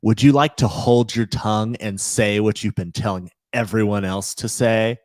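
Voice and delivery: loud, stern teacher call-out. Retold or imitated. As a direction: call the kid out in front of class. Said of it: "She goes".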